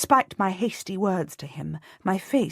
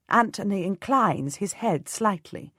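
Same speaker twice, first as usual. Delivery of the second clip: ordinary delivery, nothing marked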